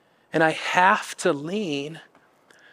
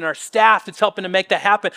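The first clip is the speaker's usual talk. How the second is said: ordinary delivery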